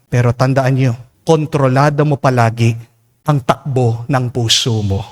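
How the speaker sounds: ordinary talk, nothing marked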